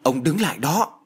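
sharp scolding shout. A narrator's reading. Wife stopping husband at the door, scolding shout. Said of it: "đã nghe một tiếng quát".

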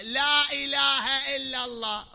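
لا إله إلا الله proclaimed loud, high and slow; emphatic declaration. Shown as a demonstration. Preacher contrasting the full formula with its stripped form, loud and slow. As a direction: call it out like a creed. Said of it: "لاحظ".

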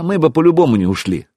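ordinary delivery

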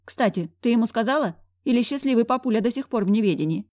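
exasperated, lively gossiping friend; brash best friend. Acted. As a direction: rant indignantly to a close friend. Voices the brash friend Zaika.

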